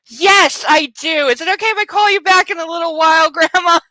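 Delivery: high, strained innocence, speeding up; imitated fake cheer. Covering up the fire to grandma.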